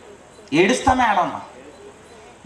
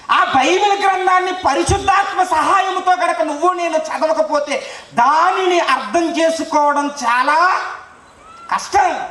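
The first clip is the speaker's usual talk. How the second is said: raised, higher-pitched pulpit delivery; forceful preaching; 8 semitones higher, 3 dB louder than usual. Real, not acted. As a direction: preach it out insistently to the congregation. (గొంతు పెంచి ఉద్వేగంగా బోధిస్తూ)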